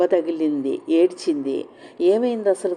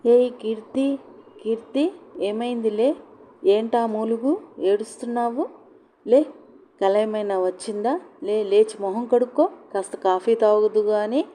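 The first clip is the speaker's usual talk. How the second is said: higher, motherly wake-up call; worried mother calling her daughter awake; mother waking daughter; 5 semitones higher than usual.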